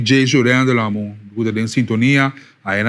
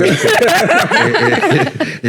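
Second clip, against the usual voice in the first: slow, high, laughing through the words; real giggle breaking the phrase; 13 semitones higher, 4 dB louder than usual. Real, not acted. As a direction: crack up mid-sentence while teasing. Host laughs while teasing guest.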